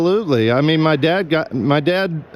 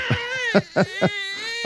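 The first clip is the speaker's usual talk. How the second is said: high-pitched helpless laugh; high squealing laugh; 6 dB quieter than usual. Real, not acted. Laughing at the clip.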